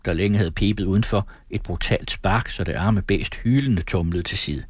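ordinary delivery, nothing marked

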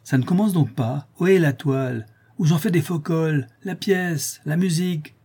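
high, impatient gallery cry; repeated theatre heckle. A narrator's reading. Tortillard stamps on the stairs for the show, high heckling cry. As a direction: call out each demand high, like a bored audience. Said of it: "ce cri répété".

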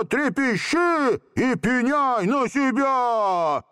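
высокий, медленный грозный крик; пугать джинна страшным голосом; scariest voice. A narrator's reading. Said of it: "самым страшным голосом". (самым страшным голосом)

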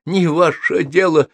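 high, slow, lightly hoarse retort; husky reply. A narrator's reading. Wet wizard rebuffs Sophie's question, husky and touchy. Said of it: "сипло ответил он".

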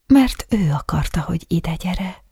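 hushed whisper, no voice; a secret whispered close. A narrator's reading. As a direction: breathe the words secretly.

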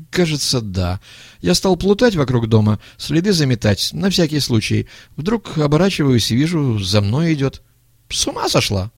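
put-on evasive young man. A narrator's reading. Young man confessing to the old man, uneasy.

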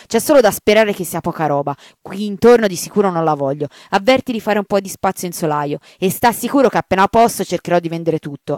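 a grumbling priest barking instructions; irritated rector. Acted. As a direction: order the sacristan about, still fuming. Rector gives angry orders.

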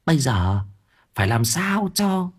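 put-on high, sly old woman murmuring in his ear; wife plotting over the tomb, high sly murmur; scheming wife whisper. A narrator's reading. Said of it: "thì thầm vào tai chồng".

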